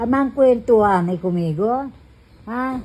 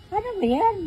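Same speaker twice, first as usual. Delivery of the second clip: high cooing question; 13 semitones higher, faster than usual. Real, not acted. Cooing at baby.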